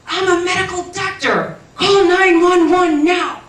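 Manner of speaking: high, loud, warped cartoon voice like speech underwater; the doctor's shout heard as a warped cartoon voice from above the body, high and loud; distorted underwater voice.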